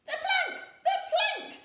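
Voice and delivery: very high, loud chant; pirates yelling. A narrator's reading. Pirates demanding the plank, yelling. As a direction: shout it out eagerly. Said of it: "yelled Ben Buckle".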